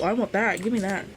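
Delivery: normal talking voice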